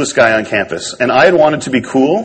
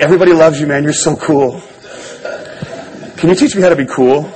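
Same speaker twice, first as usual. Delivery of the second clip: gushing fan; 3 semitones higher than usual. Retold or imitated. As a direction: gush at him like a starstruck rookie. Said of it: "And I was like".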